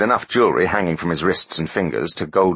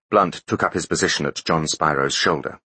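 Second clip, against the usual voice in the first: usual speaking voice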